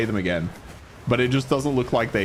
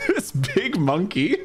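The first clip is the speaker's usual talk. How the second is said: high, drawn-out words through laughter; amused laughing; 10 semitones higher, much slower than usual. Real, not acted. Amused at his giant monkey.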